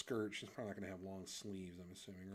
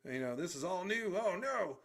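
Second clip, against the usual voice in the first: loud, high mock alarm; alarmed visitor; 8 semitones higher, 8 dB louder than usual. Visitor to whom this is all new, high, loud mock panic. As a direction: play up the newcomer's dismay.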